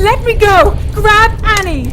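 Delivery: high loud frantic yell; desperate scream. Acted. Elizabeth begging Harry to drop her, frantic scream. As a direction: beg him to drop you.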